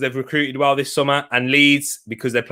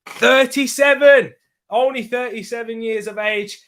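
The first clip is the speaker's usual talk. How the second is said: surprised exclamation; 8 semitones higher than usual. Real, not acted. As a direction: exclaim the number up high, then ease off. Reacting to the viewer's age.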